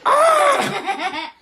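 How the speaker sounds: high, drawn-out mock squawk; seagull scream. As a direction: shriek like a seagull under attack. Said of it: "And the seagull went".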